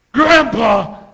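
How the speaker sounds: loud, high shout for grandpa; big high cartoon yell; calling out. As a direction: holler it like an excited manga kid. Acted grandchild calling out in manga.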